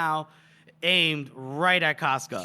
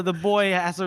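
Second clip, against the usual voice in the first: ordinary delivery, nothing marked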